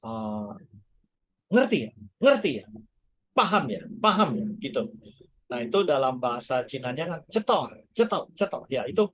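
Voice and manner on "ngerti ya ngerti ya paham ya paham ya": pushy repeated checking. Retold or imitated. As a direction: nag him like he always does, checking if you got it. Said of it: "beliau itu selalu kalau ngomong itu".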